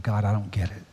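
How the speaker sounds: tearful prayer. Retold or imitated. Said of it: "in tears going".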